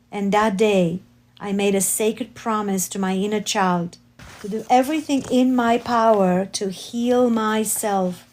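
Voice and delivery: ordinary delivery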